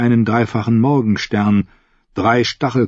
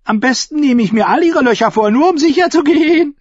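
high, loud, sneering jester voice; mocking mad fool; 5 dB louder than usual. A narrator's reading. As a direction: taunt the crippled smuggler gleefully. Shackwell jokes crudely. (höhnischer Narr, hoch und laut)

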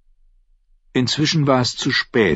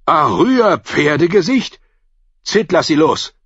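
higher, louder amazed voice; stunned recognition; astonished; 8 semitones higher, 4 dB louder than usual. A narrator's reading. Harwin recognizes Arya.

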